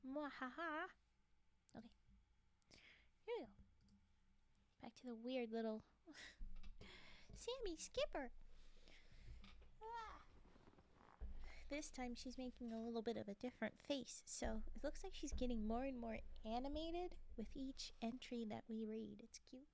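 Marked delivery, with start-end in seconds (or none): 0.1-0.9 mad scientist cackle. Pretend. Spelt evil laugh after gloat. 19.2-19.7 soft whispered aside; whispering. Real, not acted. Soft aside about drawing.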